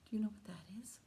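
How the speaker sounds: whispered. Acted. Hushed question to kids.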